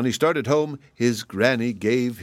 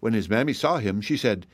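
plain reading voice

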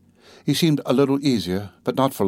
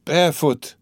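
repeated mutter; 5 semitones higher than usual. A narrator's reading. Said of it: "he muttered".

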